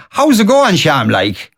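lilting Irish Midlands accent, high then falling; Irish Midlands sing-song, starting high; Ballinasloe accent. Shown as a demonstration. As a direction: greet like a Ballinasloe local. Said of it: "in Ballinasloe, somebody would say".

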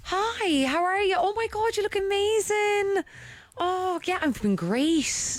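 high, gushing mock greeting; high-pitched drawn-out lick-arse flattery; sucking up. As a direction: gush at the bosses, hammed-up. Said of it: "Oh, you lick arse".

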